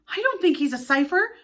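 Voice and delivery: put-on high, loud eager boy's voice; eager cry. Toodles defending Mister Darling, eager high cry. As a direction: cry it out at once. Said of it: "Toodles cried instantly".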